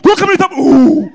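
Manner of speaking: high, loud mimed Lari storytelling; Lari accent acting. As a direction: act out the crash in a Lari accent. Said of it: "le jeu d'acteur".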